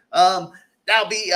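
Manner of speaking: ordinary talk, nothing marked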